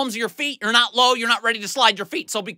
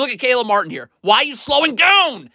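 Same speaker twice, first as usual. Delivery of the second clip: ordinary talk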